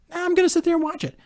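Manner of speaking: high, fast, put-on voice brushing off his dad; squeaky quick retort of a stubborn kid; defiant younger self. To his dad, a high quick defiant reply. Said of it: "I'm like".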